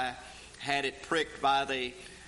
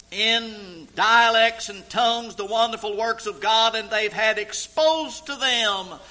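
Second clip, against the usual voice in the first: full-force preaching; 7 semitones higher, 10 dB louder than usual. Acted. Preacher to congregation about God's wonderful works, fervent preaching. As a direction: drive each phrase home with fervor.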